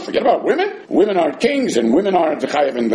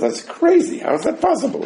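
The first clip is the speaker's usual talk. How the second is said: much higher, incredulous outcry; shocked disbelief; 8 semitones higher than usual. Retold or imitated. Students hearing the ruling, mock shocked outcry. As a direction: sound floored by the ruling. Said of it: "which everybody says".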